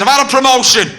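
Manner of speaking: high, loud proud announcement; drunk bragging. Retold or imitated. Drunk Harry boasting to furious girlfriend, high loud proud. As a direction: crow it like big news.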